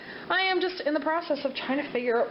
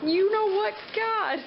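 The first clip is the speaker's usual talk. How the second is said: relieved laughter; 10 semitones higher, slower than usual. Real, not acted. Relief at last day.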